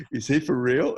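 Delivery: mock-incredulous outburst; imitated freak-out. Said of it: "they're all freaking out".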